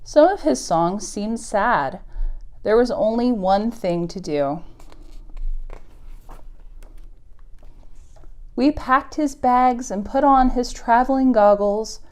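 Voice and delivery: gentle picture-book read-aloud; story reading. Acted. Teacher reading a moose story to kids, storybook voice. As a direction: read it to the little ones, unhurried.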